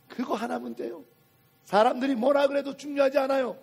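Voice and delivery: usual speaking voice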